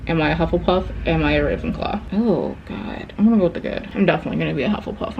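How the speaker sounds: ordinary talk, nothing marked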